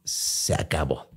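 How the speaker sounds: breathless near-whisper of defeat; hushed quote. Retold or imitated. Telling a friend the night he gave up, whispered. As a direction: let it drop out as barely a breath. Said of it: "en mi vida dije".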